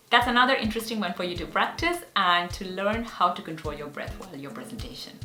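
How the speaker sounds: usual speaking voice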